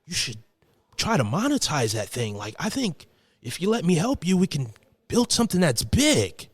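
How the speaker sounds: high-pitched, emphatic dude voice; friend impression. Friend pitching help with monetizing, high dude impression. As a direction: imitate a keen buddy making his pitch. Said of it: "Sound like him".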